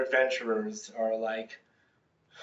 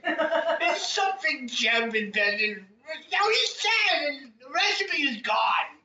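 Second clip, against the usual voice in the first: wailing actor voice; 13 semitones higher, 3 dB louder, slower than usual. A narrator's reading. Voicing the actors.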